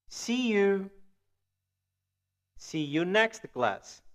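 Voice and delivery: slow, carefully enunciated English phrases; deliberate demonstration with long pauses between phrases; language-teacher model reading. Shown as a demonstration. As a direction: read each phrase slowly for learners to repeat. Teacher rereads model phrases for repetition.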